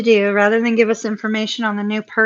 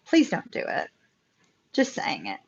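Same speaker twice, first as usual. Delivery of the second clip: whispered plea; 5 semitones lower, 8 dB quieter than usual. Real, not acted. Pleading singles against love spells.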